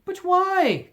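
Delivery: high, loud cry of dismay; cried out. Acted. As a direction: call out in disbelief. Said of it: "cried Scrooge's nephew".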